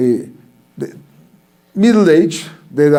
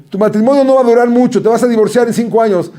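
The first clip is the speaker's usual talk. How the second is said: higher, fast mock seer prophecy; 7 semitones higher, 3 dB louder, faster than usual. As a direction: rattle off the doom like a play fortune teller. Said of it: "a hablar como si fuera una gitana".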